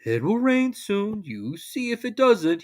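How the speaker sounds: slow glum grumble; glum Eeyore. A narrator's reading. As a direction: drag it out like a mopey donkey. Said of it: "nodded glumly".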